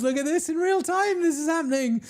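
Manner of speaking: high, giddy exclamation; thrilled squeal. Real, not acted. Streamer squeals as viewers turn the lights blue. Police lights arriving live.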